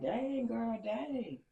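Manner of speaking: high, drawn-out mock amazement; high-pitched retold "dang" reaction; amazed onlookers. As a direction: imitate the impressed crowd, pitch up.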